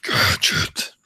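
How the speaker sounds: unvoiced villain rasp; Doctor Claw. Shown as a demonstration. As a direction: rasp it like a cartoon arch-villain. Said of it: "Doctor Claw from Inspector Gadget".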